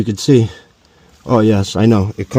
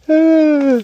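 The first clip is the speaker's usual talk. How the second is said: exasperated high sigh. Real, not acted.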